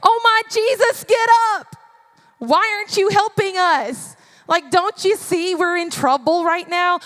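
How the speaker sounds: high, loud panicked pleading; acted freak-out, easing slightly towards the end; frantic. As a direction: cry out to wake a sleeper for help. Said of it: "They're frantic".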